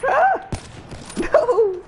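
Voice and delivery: very high sudden squeal; startled yelp. Real, not acted. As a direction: yelp like you got ambushed. Getting killed in game.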